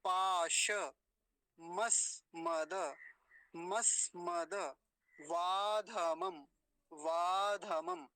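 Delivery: slow, drawn-out piece-by-piece model; word broken into parts. Shown as a demonstration. Teacher breaks down the big word for students, slowly, part by part. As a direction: stretch each piece and leave gaps between. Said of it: "अब देखिए बड़ा वाला शब्द".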